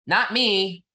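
high, loud mock protest from a listener; mock objector. Guest mimics conservative listeners objecting, a hint of a whiny protest. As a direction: mimic a defensive heckler. Mocking imagined defensive listeners.

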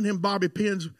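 usual speaking voice

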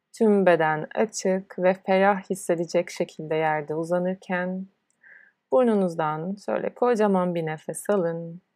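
usual speaking voice

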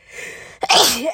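a sudden real sneeze; sneezing. Real, not acted. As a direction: sneeze suddenly mid-talk. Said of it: "I have to sneeze".

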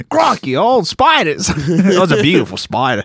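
hammed-up Australian exclamation; Australian accent. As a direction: marvel in a broad Australian accent. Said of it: "take anyone with an Australian accent".

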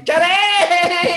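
high, loud playful retraction; joking shout. Real, not acted. Joking after insulting the other section, loud playful shout. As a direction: shout it out to undo the jab.

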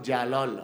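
JALOL with a rounded O-like second vowel; correct pronunciation. Shown as a demonstration. Guest confirming the host's attempt, correctly pronounced. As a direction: say Jalol as "ya-LOL", long last vowel. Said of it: "así debería pronunciarse".

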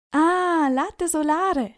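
the penny drops, call it out happily; delighted exclaim. A narrator's reading. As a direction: shopkeeper finally understands the boys. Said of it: "ruft er".

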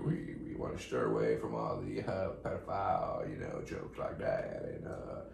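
low, lazy celebrity drawl; put-on mellow star voice; laid-back celebrity impression. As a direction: ramble like a mellow movie star. Stays in character, steering the show.

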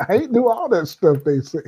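high, whiny-comic protest of innocence; aggrieved devil. Retold or imitated. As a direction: play a wronged devil pleading his case. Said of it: "He said".

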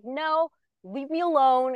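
put-on slow, pushy New Jersey accent; lightly imitating a New Jersey accent. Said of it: "in her New Jersey accent".

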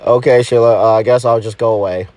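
very low, defeated mumble; dejected boyfriend. Acted. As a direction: give up and slink off, low-voiced. Rejected boyfriend gives up.

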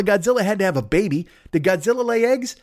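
normal talking voice

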